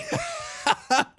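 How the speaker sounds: high-pitched burst of laughter. Real, not acted. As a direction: break into a high laugh at the joke. Laughs at own joke.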